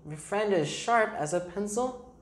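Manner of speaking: ordinary delivery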